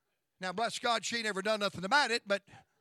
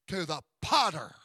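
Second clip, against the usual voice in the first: ordinary talk, nothing marked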